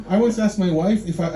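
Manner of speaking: normal talking voice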